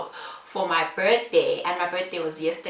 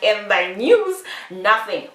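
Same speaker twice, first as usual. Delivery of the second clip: loud, drawn-out inner outburst; inner disbelief; 8 dB louder, slower than usual. Retold or imitated. Interviewee exclaiming to herself about the question, mock-dismayed. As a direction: stretch it out loudly, mock-dismayed. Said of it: "in my heart, I'm like".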